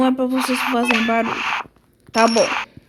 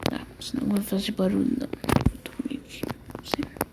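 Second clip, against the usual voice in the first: whispering while hiding; 2 semitones lower, 10 dB quieter than usual. Pretend. Doll bedtime, keeping quiet.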